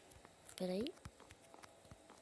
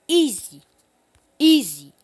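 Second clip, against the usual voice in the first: triumphant shouting; 23 dB louder, slower than usual. Real, not acted. Triumphant after clearing the course.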